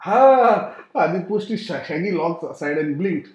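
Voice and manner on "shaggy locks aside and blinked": plain reading voice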